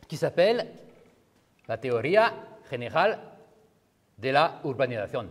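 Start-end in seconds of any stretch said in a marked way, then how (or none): 1.7-5.3 put-on Spanish accent, phrase by phrase. Shown as a demonstration. Lecturer giving the book's title in Spanish accent. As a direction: read the title with Spanish sounds. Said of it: "j'ai un hyper bon accent, euh, espagnol".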